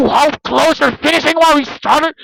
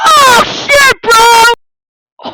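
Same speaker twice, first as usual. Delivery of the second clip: shocked high exclamation; 6 semitones higher, much slower than usual. Acted. Shocked at what he sees.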